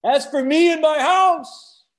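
high, loud, stretched-out declaration; booming proclamation. Acted. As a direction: shout it slowly, holding each final word. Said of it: "I can just hear everybody go".

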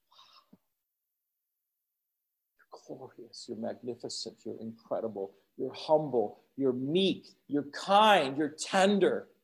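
whispered adoration of a glorious God; hushed awe. Acted. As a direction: breathe the praise in quiet wonder. Hushed worship of the Lord.

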